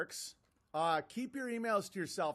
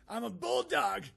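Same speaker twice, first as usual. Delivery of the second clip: mock tough-guy boast, higher and louder; mocking bulldog boast; 6 semitones higher, 5 dB louder than usual. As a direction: puff it up to ridicule him. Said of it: "Full meltdown".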